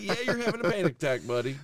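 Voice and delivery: high, loud, fast laugh-talk; laughing as he tells his boy he's panicking; giggly fast reassurance to his son; laughing through it. Retold or imitated.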